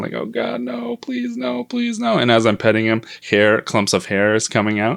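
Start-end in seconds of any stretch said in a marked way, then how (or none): 0.1-2.2 high, soft pleading wail; freaking out. Retold or imitated. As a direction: plead in a thin falsetto, imitating panic. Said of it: "I'm freaking out".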